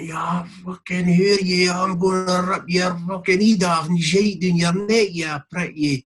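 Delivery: high-pitched imitated rant in broad Fife; broad Fife accent. Ali threatens the player who fouled him, in broad Fife accent. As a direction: mimic an irate Fifer in thick local accent. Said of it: "Ali broad Fife accent".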